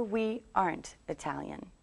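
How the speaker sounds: "aren't" joined as one word; contracted negative. Shown as a demonstration. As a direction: contract "are not" into "aren't". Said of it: "so we could say".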